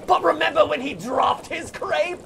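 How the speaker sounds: milk the drama like a reality show; overdramatic TV callback. Retold or imitated. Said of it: "mock TV drama recap".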